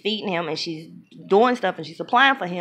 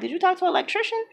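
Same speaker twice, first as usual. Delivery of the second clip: high, rapid skeptical question, a touch softer; unimpressed wife; 12 semitones higher, 4 dB quieter, much faster than usual. Retold or imitated. Wife not happy for her husband, retold skeptical question. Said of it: "Instead of her being happy for him".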